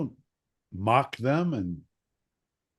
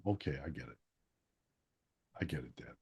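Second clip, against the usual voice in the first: much lower, much softer meek giving in; cowed kid; 8 semitones lower, 12 dB quieter, much faster than usual. Retold or imitated. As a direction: give in, beaten down. Kid cowed after being hit.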